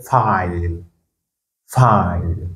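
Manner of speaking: "file" with i drawn out as eye; English I sound. Shown as a demonstration. Teacher repeats an example for learners, "file" with long eye vowel. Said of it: "on a dit, c'est I".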